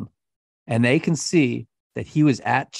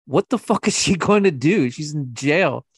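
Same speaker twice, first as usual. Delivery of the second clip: high-pitched, laughing disbelief; amused incredulity; 4 semitones higher, 3 dB louder than usual. Real, not acted. Podcaster mocking jailed wife's offer to help, incredulous. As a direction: scoff with a laugh, pitch jumping up.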